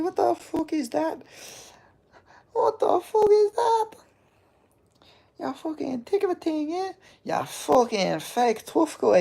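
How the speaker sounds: a cartoonish meltdown, voice cracking up high; over-the-top fake outrage, voice breaking high; hammed-up rage. Hammed-up rage at chatter insult.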